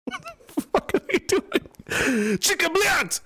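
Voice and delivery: high, drawn-out squawk of disbelief; outraged outburst. Acted. Outraged reaction to the news.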